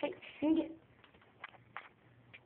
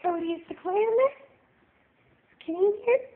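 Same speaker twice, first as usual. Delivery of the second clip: put-on reading to a toddler; 5 semitones higher, 4 dB louder, faster than usual. Coaxing toddler to find cat.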